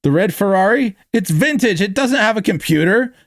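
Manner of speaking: high-pitched shocked protest; gasping disbelief. A narrator's reading. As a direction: react aghast, voice shooting up.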